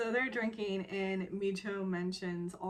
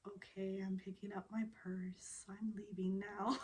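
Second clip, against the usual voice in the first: soft, defeated near-whisper; meek whisper; 7 dB quieter than usual. Retold or imitated. Daughter caving to her dad on the phone, meek whisper. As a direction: shrink away as you give in.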